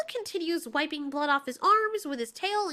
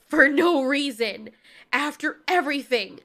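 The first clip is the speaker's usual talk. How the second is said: loud, slowed emphasis on each phrase; upset reproach; 7 dB louder than usual. Acted. Mothra reproaches Godzilla for killing humans, upset. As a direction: push the words out in indignation.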